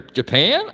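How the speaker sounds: Japan pitched high, swooping up at the end; rising questioning tone. Shown as a demonstration. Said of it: "how my voice went up there".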